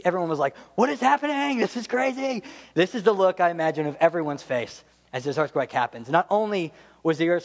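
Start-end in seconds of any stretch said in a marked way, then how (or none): 0.8-2.4 hammed-up alarmed disbelief; mock panic. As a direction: imitate a crowd freaking out in an earthquake. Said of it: "the look of pure".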